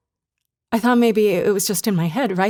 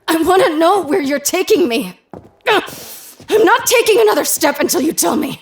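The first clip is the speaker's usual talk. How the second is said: higher, loud defiant demand; 9 semitones higher, 5 dB louder than usual. Acted. Refusing to take another step, demanding. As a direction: dig in and demand an answer.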